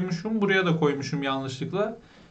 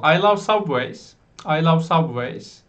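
slow, deliberate English; slow model sentence; slower than usual. Shown as a demonstration. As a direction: spell it out slowly for students. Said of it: "Videoyu durdurun, yapın".

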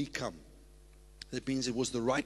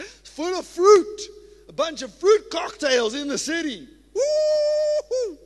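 much higher and louder, slow rapturous cry; ecstatic shouting; 12 dB louder, slower than usual. Real, not acted. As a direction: shout it out overflowing with joy. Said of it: "I'm getting a little excited".

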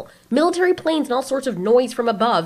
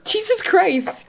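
drawn-out, higher "Jesus Christ" in disbelief; exasperated exclamation; 8 semitones higher, much slower than usual. Real, not acted. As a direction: stretch it out, fed up. Mocking a creepy comic panel.

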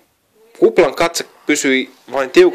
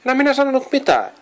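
high, fast, defensive little bird voice; defensive squeak; 14 semitones higher, much faster than usual. A narrator's reading. Joni defends himself to his brothers, high and fast. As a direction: protest quickly in a high squeaky voice. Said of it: "Joni puolustautui".

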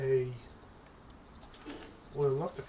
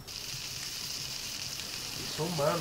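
slow breathy whispered aside; 4 dB quieter, much slower than usual. Real, not acted. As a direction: breathe it out slowly. Odd aside about pal.

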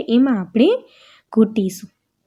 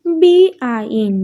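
letters spelt out slowly one by one; slow letter-by-letter spelling; 3 dB louder, slower than usual. Shown as a demonstration. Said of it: "અહીં આપણને spelling આપેલા છે".

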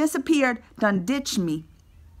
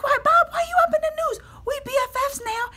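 much higher, loud whiny plea; mock wail; 12 semitones higher, 5 dB louder, faster than usual. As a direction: cry out to Bob like a betrayed best friend. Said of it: "I was like".

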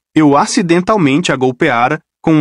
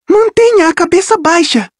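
urgente. Acted. Rachel escondida.

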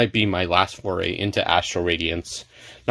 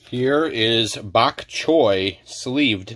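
usual speaking voice

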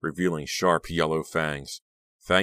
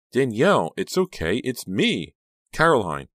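raised, higher urgent voice; louder, higher, insistent reassurance; urgent calling out; 7 semitones higher, 5 dB louder than usual. Acted. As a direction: call out to calm a frightened friend. Friend calling out to frightened girl.

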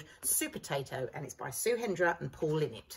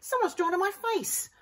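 higher, indignant voice; upset broccoli; 13 semitones higher, 3 dB louder than usual. A narrator's reading. Broccoli upset about a doodled face. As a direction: complain in dismay. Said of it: "cried Broccoli".

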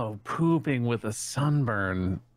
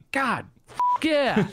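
silly high voice; 9 semitones higher than usual. Pretend. Savouring drink in silly voice.

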